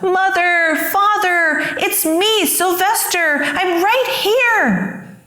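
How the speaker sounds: high, pleading call to his parents; a son calling out to his parents, high and pleading; silent longing shout. A narrator's reading. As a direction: cry out in a high voice to be heard. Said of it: "How he wanted to shout".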